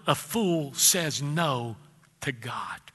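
drawn out slowly, word by word. Shown as a demonstration. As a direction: lean on each word, slow and low. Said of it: "I'm gonna say that real slow".

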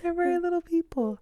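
giggling high through the words; squeezing words out between giggles; laughing. Real, not acted. Laughing at short couple.

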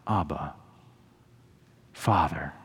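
low, hushed appeal to a father; quiet, weighty plea with a long pause; subdued anguished prayer. Acted. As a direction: drop low and let each word stand alone. Retelling Jesus' anguished cry to God.

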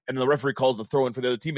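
normal talking voice

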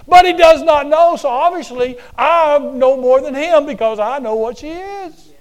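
loud, high, scornful scoff; proud Pharisee mocking loudly; smug scorn. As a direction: sneer down at the woman and the guest. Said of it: "the attitude of the Pharisees is".

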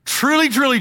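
truly, truly rung out high and loud; proclaimed. Acted. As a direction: announce it like a herald. Preacher proclaiming Jesus's words.

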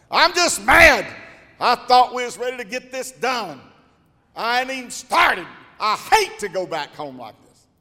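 high, loud, hammed-up anger; wife's mock fury. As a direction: play the fed-up wife, loud. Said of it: "I'm so mad".

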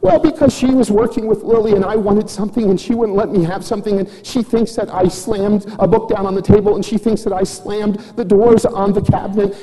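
put-on high, whiny child's explanation; put-on kid voice, running on breathlessly through her excuses; Chloe. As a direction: imitate a little girl pleading her case. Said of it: "she said".

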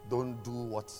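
ordinary talk

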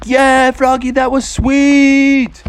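high, drawn-out cheer; cheering friends. Acted. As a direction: whoop for a pal's big jump. Friends cheering Froggy's jump.

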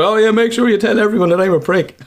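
high, loud mocking quote; mate mocking the podcast threat, high and loud. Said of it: "So he made sure to".

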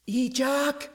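high, drawn-out distant call; high-pitched holler, held back in volume. Acted. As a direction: call the name from over a hill. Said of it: "Carmi called from over the edge".

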